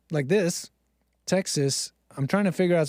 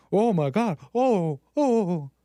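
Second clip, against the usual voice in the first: mock horror, drawn-out ohs; pearl-clutching; 2 semitones higher than usual. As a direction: gasp like a scandalised pearl-clutcher, hammed up. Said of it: "pearl clutching".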